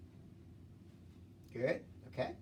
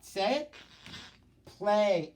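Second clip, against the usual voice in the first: normal talking voice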